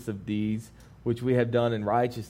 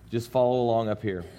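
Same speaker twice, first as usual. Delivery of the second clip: normal talking voice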